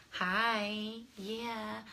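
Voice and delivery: soft, breathy phone-flirt voice; very quiet come-on; sexy voice. Shown as a demonstration. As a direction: put on a hushed, sultry hello. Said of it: "I got my sexy voice".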